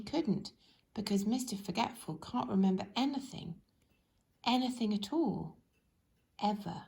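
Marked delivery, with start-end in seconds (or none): none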